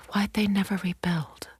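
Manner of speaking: hushed question; whisper. Acted. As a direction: breathe the words in awe. Wondering in ruined market.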